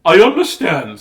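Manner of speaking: a hint of an effusive teacher; teacher's voice. Teacher answering disappointed pupil, lightly voiced teacher. Teacher consoling child in story.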